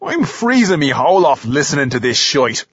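low, irritated lad calling out, not at full volume; shout. A narrator's reading. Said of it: "he shouts".

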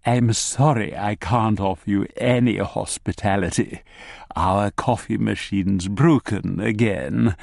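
slow posh Edinburgh academic; smooth, posh Morningside host; posh Morningside accent. Acted. MacDonald's posh voice.

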